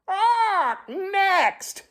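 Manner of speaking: high, piercing parrot squawk; blaring parrot. Acted. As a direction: blare it like a parrot. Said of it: "blared the parrot".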